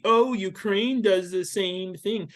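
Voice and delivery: high, drawn-out mock objection; scornful slow imitation; mocking quote. Mocks an opponent's objection.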